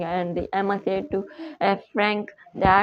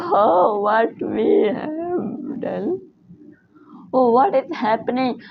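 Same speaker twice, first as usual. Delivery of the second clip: shocked dismay; 4 semitones higher, 3 dB louder, slower than usual. Acted. Family sees they changed.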